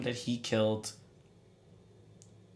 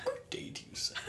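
laughing whisper; 10 dB quieter than usual. Acted. Prim reply, laughing.

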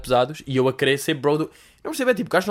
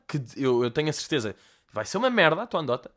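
ordinary talk, nothing marked

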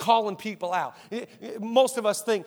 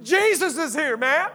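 excited announcement; 7 semitones higher, 3 dB louder than usual. Retold or imitated. Andrew excitedly tells his brother.

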